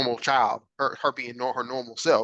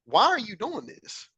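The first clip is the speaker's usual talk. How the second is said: self-questioning; 8 semitones higher than usual. Retold or imitated. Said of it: "I would ask myself".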